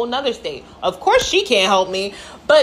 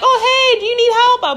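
much higher, bubbly offer of help; eager stranger; 13 semitones higher, 4 dB louder than usual. Acted. Stranger offering to help a lost hiker, high and over-friendly. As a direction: pitch it way up like an over-friendly passerby.

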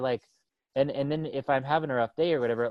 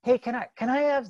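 put-on high, eager small boy's voice; five-year-old Johnny asking for candy, high and eager; little kid asking; 11 semitones higher than usual.

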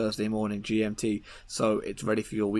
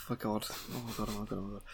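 embarrassed mutter; 11 dB quieter, faster than usual. Real, not acted. Embarrassed after rude joke.

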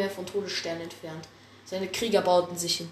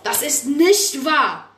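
loud, high, slow protest; outraged shout; 6 semitones higher, 10 dB louder, slower than usual. Acted. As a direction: shout it out as the accused leader. Blutstern jumps up protesting.

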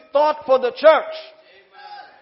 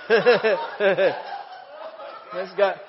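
ordinary talk

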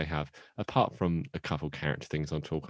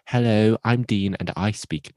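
loud, hammed-up regal English accent; Queen Victoria; 5 semitones higher, 6 dB louder than usual. Shown as a demonstration. Podcaster attempting a bad English accent lands on a queenly voice, loud mock-English. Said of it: "that's my Queen Victoria".

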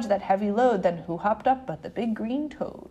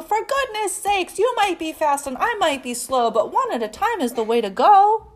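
raised, exasperated scolding; yelled; 5 semitones higher, 5 dB louder than usual. A narrator's reading. Blue to squabbling cars, yelled plea. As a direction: call out over the tangled traffic. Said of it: "yelled Blue".

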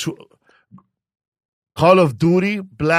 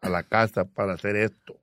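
put-on Spanish with a Cuban accent; 5 semitones lower, 3 dB quieter than usual. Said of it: "speaking in Spanish with a Cuban accent".

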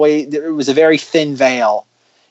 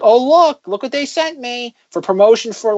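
mock excited blogger; 8 semitones higher than usual. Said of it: "as a way of like".

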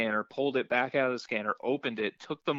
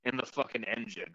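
usual speaking voice

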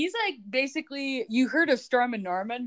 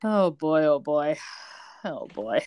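low mock groan; 6 semitones lower, slower than usual.

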